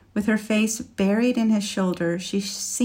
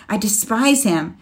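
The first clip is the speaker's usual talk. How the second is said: louder and higher, fury close to tears; fierce, near tears; 4 semitones higher, 6 dB louder than usual. A narrator's reading. Woman raging at the dragon who ruined her life, near tears. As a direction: rage building toward tears. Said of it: "She was nearly crying now".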